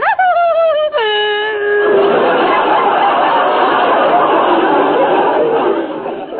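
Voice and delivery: wailing, sobbing laugh of delight; over-the-top swooning shriek-laugh. Acted. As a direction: let out a long hooting cackle. Character's big swooning laugh.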